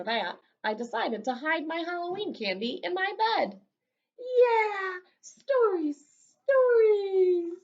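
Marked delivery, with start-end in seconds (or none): 4.2-7.5 high, loud, thrilled cry of stories; big excited kid voice; excited shout. Acted.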